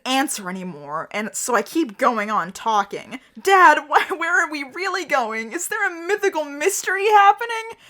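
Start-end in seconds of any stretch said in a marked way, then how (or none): 3.4-7.8 high, stammering plea; mock-crying, pitch climbing into the question; tearful questioning. A narrator's reading. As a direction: plead like a scared, weeping kid. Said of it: "because I'm crying real hard".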